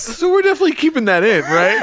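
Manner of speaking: fast, high through giggles; talking while cracking up; laughing. Real, not acted. Laughing after botched prank call.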